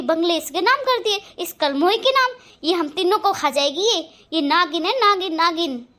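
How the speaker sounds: loud, fast, venomous tirade; furious rant. Acted. Stepmother raging to husband about stepdaughter, shouting. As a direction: scream it, calling the girl a snake.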